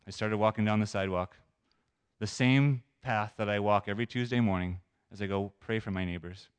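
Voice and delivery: ordinary talk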